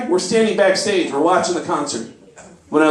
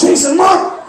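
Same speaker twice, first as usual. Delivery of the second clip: high, louder urgent call; urgent excitement; 11 semitones higher, 4 dB louder than usual. Retold or imitated. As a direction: point it out, thrilled. Urgent pointing out.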